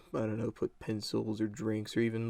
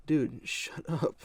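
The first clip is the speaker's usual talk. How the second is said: laughing, slow and higher; 5 semitones higher, slower than usual. Real, not acted. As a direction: laugh through the scolding. Laughing at annoying friend.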